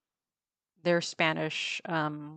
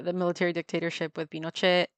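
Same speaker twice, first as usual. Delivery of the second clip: ordinary delivery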